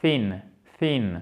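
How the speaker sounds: THIN avec TH soufflé, ton descendant; TH anglais correct. Shown as a demonstration. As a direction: langue entre les dents au début de thin. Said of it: "c'est thin". (thin avec un vrai TH, ni F ni Z)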